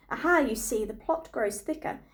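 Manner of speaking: usual speaking voice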